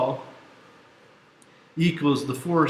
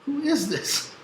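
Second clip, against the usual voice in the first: high baffled squeak of a question; 13 semitones higher, slower than usual. Retold or imitated. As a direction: sound completely thrown by a late call. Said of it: "And I'm like".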